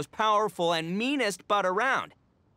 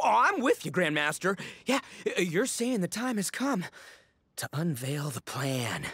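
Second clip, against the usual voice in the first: usual speaking voice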